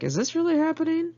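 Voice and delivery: high, incredulous question; disbelief. Retold or imitated. Narrator recalling drug dealer's request, incredulous. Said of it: "I was like".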